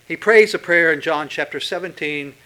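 usual speaking voice

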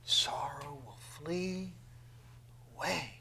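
slow, drawn-out whisper. Real, not acted. Preacher echoing the verse, slow whisper. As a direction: breathe it out slowly.

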